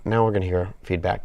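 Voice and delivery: ordinary delivery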